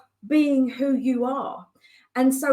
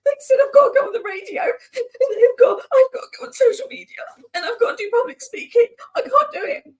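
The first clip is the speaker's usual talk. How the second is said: high, tearful wailing; half-laughing, half-crying mock sob; retelling a breakdown in a high crying voice; sobbing panic; 12 semitones higher, 5 dB louder than usual. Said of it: "I was like".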